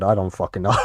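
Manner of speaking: normal talking voice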